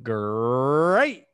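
long rolled growl rising into a loud finish; big hammed-up tiger roar on the word; Tony the Tiger. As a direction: draw out a booming cartoon catchphrase. Tony the Tiger catchphrase imitation.

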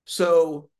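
ordinary talk

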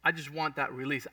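ordinary delivery

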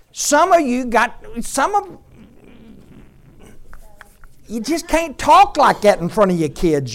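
loud, high preacher's cry, pounding each point; fiery sermon delivery; preaching at full force. Acted. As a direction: preach it hard to the whole room.

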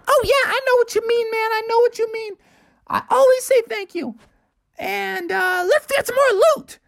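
put-on high, fast little kid voice; high, quick cub eager for loot; little bear cub. A narrator's reading. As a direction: chatter like an eager little brother. Junior Bear answers brother.

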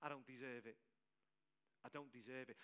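hushed, humbled confession; a small, ashamed voice; quiet repentance. Retold or imitated. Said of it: "he went into repentance".

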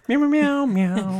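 high singing meows; kitten voice. Pretend. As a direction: sing it like a little cat. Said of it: "we're supposed to sing as cats".

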